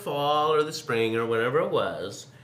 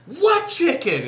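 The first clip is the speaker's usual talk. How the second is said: high, loud mock question; a kid's high, loud innocent what; imitating himself; 8 dB louder, slower than usual. Boy asking his brother which chicken, high loud mock voice. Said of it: "I'd be like".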